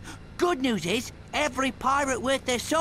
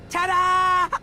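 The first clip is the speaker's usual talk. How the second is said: loud showman's ta-da, flung out high; grand ta-da; 4 semitones higher, 7 dB louder than usual. Acted. Pirate unveils his robot captain to the lumbax, in a loud showman's flourish. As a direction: present it like a magic trick, full voice.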